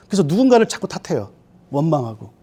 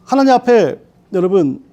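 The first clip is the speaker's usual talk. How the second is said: normal talking voice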